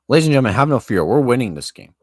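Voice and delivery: rally the audience with a burst of emotion; worked-up, booming reassurance to viewers; getting emotional. Real, not acted. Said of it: "Sorry, now I'm getting emotional".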